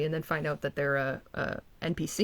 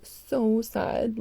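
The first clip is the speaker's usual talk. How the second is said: mock pity; 5 semitones higher than usual. Real, not acted. Mocking the sad face.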